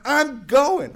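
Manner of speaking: high, loud final word; defiant. Retold or imitated. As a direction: slam the door on the argument. Defiant reply to members.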